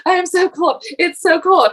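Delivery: lightly whiny husband; mock whining cold. Husband complaining.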